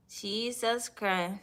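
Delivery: ordinary talk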